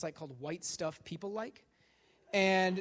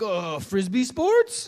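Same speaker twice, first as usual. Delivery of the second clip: mock diplomat; 3 semitones higher, 12 dB louder, much slower than usual. Business meeting quote voiced.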